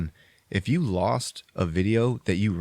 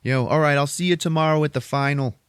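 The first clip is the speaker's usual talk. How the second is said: higher, louder ribbing; cocky higher voice, a bit louder; teasing friend; 6 semitones higher, 4 dB louder than usual. Retold or imitated. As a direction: rib your buddy on the way out. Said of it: "He's like".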